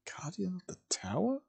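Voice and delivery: soft, breathy questioning echo; hushed, baffled question; puzzled echo. A narrator's reading. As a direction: repeat it quietly, rising in disbelief. Four puzzled listeners echo a title.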